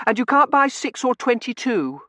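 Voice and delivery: put-on very high, loud child voice; piercing, indignant schoolgirl protest; rule-quoting little girl. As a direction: insist on the rules like a bossy child. Child objects in story.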